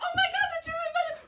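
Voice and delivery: very high, fast excited squeal; gushing fans. Retold or imitated. Quoting everyone's reaction to the German mother, high gushing squeal.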